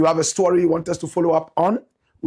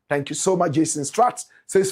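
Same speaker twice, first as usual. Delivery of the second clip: normal talking voice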